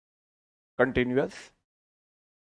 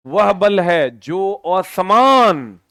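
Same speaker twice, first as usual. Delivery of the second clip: slow dictation; much slower than usual. Shown as a demonstration. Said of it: "slow defining read".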